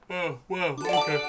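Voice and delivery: ordinary delivery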